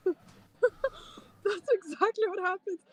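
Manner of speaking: laughing. Real, not acted. Laughing agreement.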